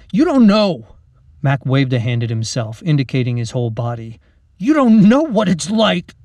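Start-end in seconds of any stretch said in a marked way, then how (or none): 0.1-1.0 loud, high, frustrated protest; heated and raised-voice; angry outburst. Acted. Acted quarrel between friends. 4.6-6.2 loud, high, pained frustration; heated, raised-voice accusation; angry outburst. Acted. Acted quarrel, then he walks away.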